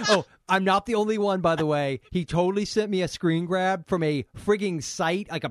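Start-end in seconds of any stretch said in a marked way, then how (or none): none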